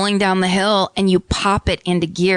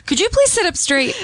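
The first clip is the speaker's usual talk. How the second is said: strict teacher voice; 11 semitones higher than usual. Pretend.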